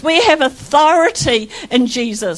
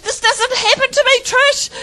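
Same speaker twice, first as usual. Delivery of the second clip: Christine's line, pitched high in delight; quoted friend, higher; 9 semitones higher than usual. Retold or imitated. Telling how the minister laughed, higher pitched quote. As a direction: echo her up high, half laughing. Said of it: "She said".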